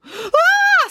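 loud, very high shriek; piercing high-pitched scream. A narrator's reading. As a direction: shriek in terror. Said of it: "screamed Pauline and Sienna".

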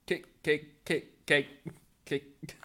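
repeating broken robot voice; glitching robot. Acted. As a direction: stutter like a malfunctioning bot.

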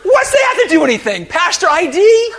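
loud, high indignant retort; really angry. Retold or imitated. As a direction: fire back in mock outrage. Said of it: "I'm really angry. Like".

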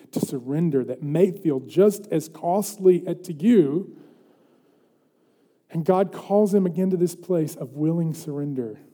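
ordinary delivery

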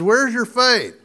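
a lightly voiced Jesus challenging Peter. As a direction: ask "Where's your faith?" up high, pressing on "faith". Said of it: "Jesus looks at him and says".

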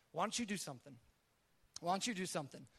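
soft, restrained prompting; God speaking inwardly. Retold or imitated. God nudging the driver to help, quiet and restrained. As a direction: repeat it quietly, with a pause between. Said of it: "I started having God speak to me".

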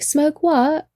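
high mock British accent; amateur British impression; put-on British accent. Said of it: "Again, not gonna do that".

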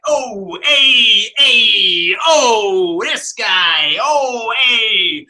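mock a swaggering mafioso waving hello to everyone; mobster flashback. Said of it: "just walking in slow motion".

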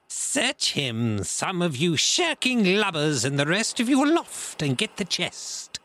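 high, loud impatient pirate bellow; swearing orders. A narrator's reading. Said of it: "swore at them again for their delay".

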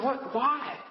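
high-pitched, baffled retold questions; feigned puzzlement. Father stopped mid-throw questions daughter, mock bewilderment. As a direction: act the innocently puzzled dad.

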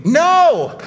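a sharp high exclaimed no; emphatic rejection, high and loud. Real, not acted. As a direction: cut the idea off with a loud NO. Rejecting a works-based gospel.

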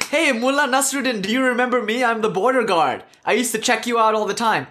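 eager greeting. Acted. Retired border guard greets the smuggler he used to check, eager greeting.

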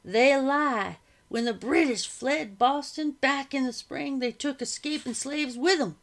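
loud, high, angry protest; frustrated outburst. A narrator's reading. Shovel man angrily warning the others, loud and high. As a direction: hammer each claim out in anger. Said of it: "drove it into the ground with frustration".

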